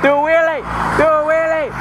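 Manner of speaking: high-pitched call to a rider. Real, not acted. Vlogger urging motorcyclist to do a wheelie, calling out. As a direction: holler it up at a passerby.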